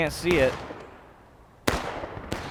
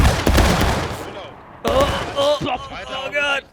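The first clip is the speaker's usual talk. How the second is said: just hit in the groin; 8 semitones higher, much slower than usual. Real, not acted. Player groaning after a hit.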